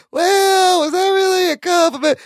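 high, whiny imitation; whiny mock question. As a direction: mimic them doubting, high and whiny. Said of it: "They were like".

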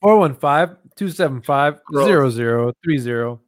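talking with a mouthful of food; mouth full. Real, not acted. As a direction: say the numbers around a bite. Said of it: "I know you got your mouth full".

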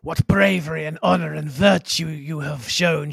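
grand regal empress voice; put-on imperious declamation; imitated Araxa. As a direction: praise solemnly like an alien queen. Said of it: "Araxa shouldn't be saying".

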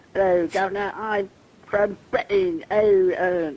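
over-the-top cheery Cockney put-on; mock Cockney. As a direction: play an American faking a Cockney accent. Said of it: "an American who pretends to be a British person always sounds Cockney".

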